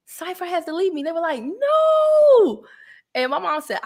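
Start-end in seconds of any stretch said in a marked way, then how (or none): 0.1-1.0 high-pitched retold complaint; mock lament. Retelling her upset announcement. 1.4-2.6 very high, loud, drawn-out mock wail; hammed-up long wail of protest; dismayed mock cry. Retelling others' dismayed reaction.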